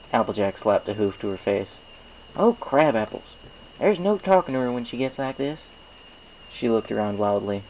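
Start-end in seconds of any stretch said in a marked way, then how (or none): 2.3-5.7 exasperated. Acted. Applejack gives up on reaching Twilight, exasperated and high. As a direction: throw up your hooves.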